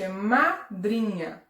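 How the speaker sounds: "madrinha" drawn out slowly and stressed; teacher stretching out "é madrinha" slowly. Shown as a demonstration. Teacher to pupils, slowly stressing "madrinha". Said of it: "é diferente".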